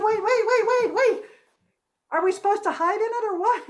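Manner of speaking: high, loud, rapid-fire pleading to stop; urgent calling out. A narrator's reading. Confused Moomins calling after the Gafsi as she runs off, urgent.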